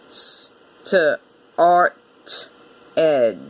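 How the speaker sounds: STARTED sounded out, s, tuh, ar, t, ed; "S-T-A-R-T-E-D" as separate sounds with gaps. Shown as a demonstration. Teacher counting sounds, STARTED sound by sound. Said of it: "Six sounds".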